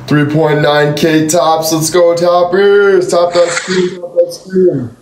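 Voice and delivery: high, slow hype shout-out; hype call. Real, not acted. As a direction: pump up the viewers.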